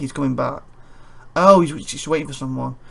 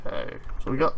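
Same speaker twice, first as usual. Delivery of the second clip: usual speaking voice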